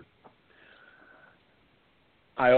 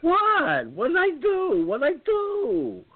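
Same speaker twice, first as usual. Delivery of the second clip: innocent what; slower than usual. Retold or imitated. Son to laughing poll ladies, mock-innocent high protest. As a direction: play dumb, all high innocence. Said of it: "I said".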